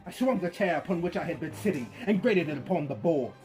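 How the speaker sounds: usual speaking voice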